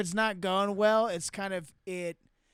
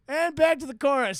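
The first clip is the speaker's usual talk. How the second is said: loud, higher announcement; mock stage call; 7 semitones higher, 8 dB louder than usual. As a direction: call out the chorus to cover a slip. Acts out bluffing through a song.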